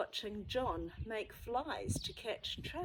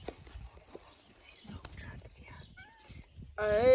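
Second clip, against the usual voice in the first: whisper; 3 semitones higher, much slower than usual. Real, not acted. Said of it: "pointing out picture to child".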